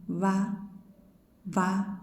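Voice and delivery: "va" with teeth on lip; va sound. Shown as a demonstration. Teacher showing the va pronunciation. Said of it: "you can sound it like".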